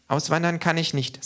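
high-pitched character voice; K, small creature voice. A narrator's reading. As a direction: say it high up, as little K. K voiced in a story. (hohe Figurenstimme)

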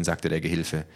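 ordinary delivery

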